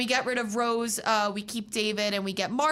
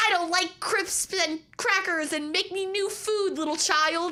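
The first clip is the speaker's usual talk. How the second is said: higher, slow hammed-up Doctor voice; mock Doctor quote; 8 semitones higher than usual. Retelling the new Doctor's line, hammed-up and higher. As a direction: play the fussy new Doctor, overdone.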